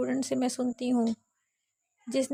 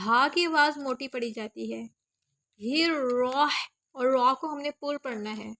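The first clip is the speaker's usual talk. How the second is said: ordinary talk, nothing marked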